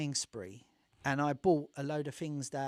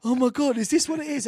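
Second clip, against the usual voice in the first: higher and louder, wide-eyed wonder; amazed gasp; 8 semitones higher, 12 dB louder than usual. Retold or imitated. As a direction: blurt it out like the first real trip hits. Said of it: "I was going".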